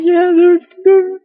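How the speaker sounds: squealing fan voice; mock cheering. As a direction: act the gleeful fan clapping along. Duke haters cheering.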